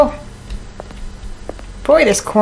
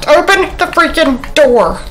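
higher, louder yell; frustrated yell; 7 semitones higher, 4 dB louder than usual. Real, not acted. Player stuck at a locked door, shouting in frustration. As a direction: yell it, fed up.

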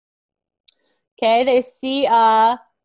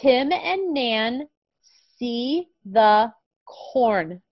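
very slow, clear reading; reading to a toddler; 2 semitones higher, much slower than usual. Shown as a demonstration. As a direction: read word by word for a small child. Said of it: "One more time with [name]".

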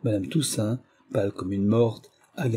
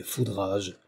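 ordinary delivery, nothing marked

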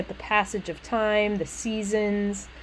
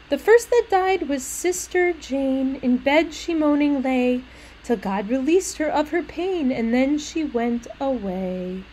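higher, measured lilting verse; sing-songy and childlike; 4 semitones higher than usual. Acted. Little maid telling of her sister's death, sing-songy. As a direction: recite death as a simple child's rhyme. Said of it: "very sing-songy and childlike".

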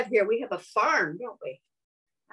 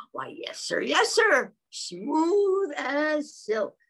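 whispered start, then higher and slow; cow answers; 6 semitones higher, much slower than usual. Acted. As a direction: whisper then lift, stretch the end.

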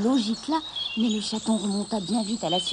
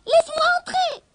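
goblin voice; 7 dB louder than usual. A narrator's reading. Goblin demands entry.

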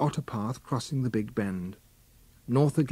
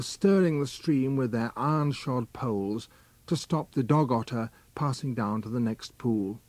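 ordinary delivery

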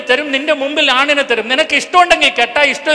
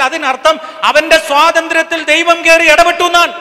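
raised, emphatic sermon voice; forceful preaching; 4 semitones higher, 4 dB louder than usual. Real, not acted. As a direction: drive the argument home, building to a loud peak. (ആവേശത്തോടെ ഉയർന്ന ശബ്ദത്തിൽ പ്രസംഗം)

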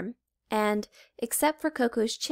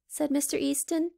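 plain reading voice

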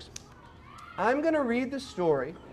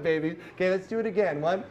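ordinary talk

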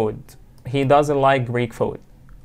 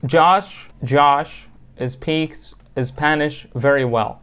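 slow example sentence; much slower than usual. Shown as a demonstration. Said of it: "This is a fact".

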